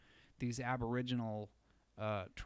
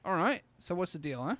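higher, louder, fast mock Aussie cop; Australian cop accent; 4 semitones higher, 5 dB louder than usual. Officer questioning a kid on a bike at night, in a mock Australian accent. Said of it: "Australian accent".

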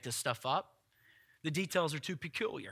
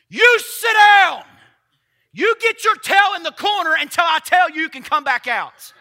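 upset parent; 12 semitones higher, 17 dB louder than usual. Acted. Jesus scolding the storm like an upset parent, shouting. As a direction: send the child to the corner. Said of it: "like a parent upset at a rowdy child".

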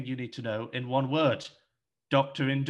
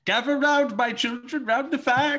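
put-on much higher, louder old storyteller voice; grandpa storyteller; 11 semitones higher, 6 dB louder than usual. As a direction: summon the children to the fire like an old grandpa. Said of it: "Come on, Papa".

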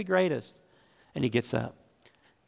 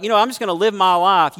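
higher, louder breezy brush-off; dismissive excuse; 6 semitones higher, 10 dB louder, faster than usual. Retold or imitated. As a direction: toss it off like a shrugging believer. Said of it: "we're like".

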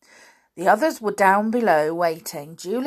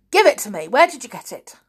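loud, raised, peremptory order; angry demand; 5 semitones higher, 7 dB louder, faster than usual. A narrator's reading. Uncle demanding the box from the children, angry demand.

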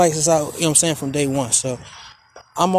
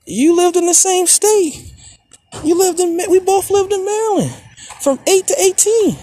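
heated protest; 10 semitones higher than usual. Real, not acted. Arguing with dad.

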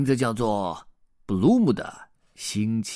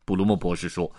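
plain reading voice

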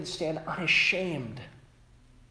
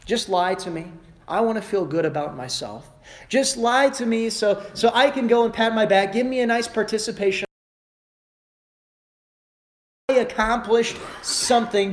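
mock whiny plea; 6 semitones higher than usual. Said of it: "is what people say".

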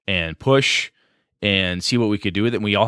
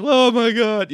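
high-pitched, hammed-up fake sobbing wail; mock fake cry; 14 semitones higher, 3 dB louder, slower than usual. Shown as a demonstration. Game master showing a player how to fake cry, mockingly. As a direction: wail it mockingly, chewing the scenery. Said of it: "you mockingly do it".

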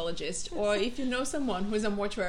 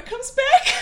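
laughing; 12 dB louder than usual. Real, not acted. Laughing at own punchline.

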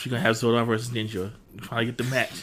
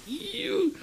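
high, drawn-out squeal of disgust; exaggerated high-pitched grossed-out noise; mock disgust. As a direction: recoil in a squeaky voice. Acting disgust at stock numbers.

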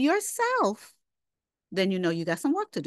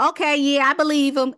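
higher and louder, breezy mock agreement; mock careless believer; 5 semitones higher, 6 dB louder than usual. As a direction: imitate someone brushing it off. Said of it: "You can't just go".

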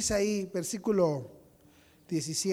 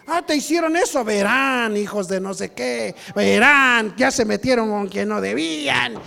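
loud, hammed-up threatening rant; mock parental outrage; 12 dB louder than usual. Parent vowing payback for the child, mock outrage. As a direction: rage like an offended parent, very loud.

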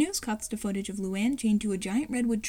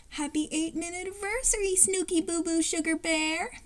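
high, sugary cooing voice; sing-song sweetheart baby talk; lovey-dovey girlfriend; 6 semitones higher than usual. Acted. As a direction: coo it like a smitten girlfriend. Lori coos pet names at boyfriend.

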